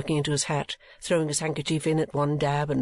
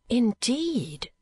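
surprised; 9 semitones higher than usual. Acted. Kate surprised.

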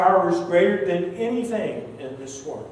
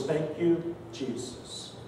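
a breathy whispered thank you, fading out; 10 dB quieter, slower than usual. Real, not acted. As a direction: breathe the thanks, almost silent. Grateful prayer at conversion.

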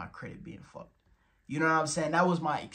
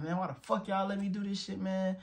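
higher-pitched exasperated complaint; retold frustration; 6 semitones higher than usual. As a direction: vent like you've been let down. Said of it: "I'm pissed off".

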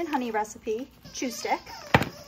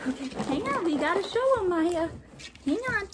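excited; 7 semitones higher than usual. Real, not acted. Excitedly showing the dogs the box.

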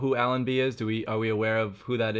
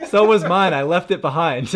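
laughing; 8 semitones higher, 10 dB louder than usual. Real, not acted. Laughing at own story.

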